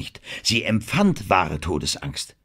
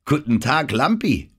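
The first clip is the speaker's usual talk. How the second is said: cheerful call; 8 semitones higher, 4 dB louder than usual. Acted. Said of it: "rief sie fröhlich".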